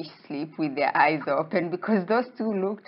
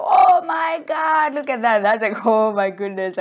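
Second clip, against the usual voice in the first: loud, high astonished outcry; loud dramatic amazement; acted shock; 6 semitones higher, 9 dB louder than usual. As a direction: exclaim in exaggerated alarm, starting very high. Acted shock on seeing the ghost.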